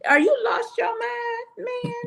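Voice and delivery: high-pitched disbelief; incredulous outburst. Acted. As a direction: squeal it out, aghast at the nerve. Incredulous reaction to eye contact story.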